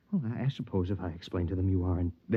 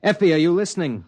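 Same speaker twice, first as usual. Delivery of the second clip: louder, higher sharp demand; husband snaps at distracted wife, loud and sharp; sharp call; 7 semitones higher, 8 dB louder than usual.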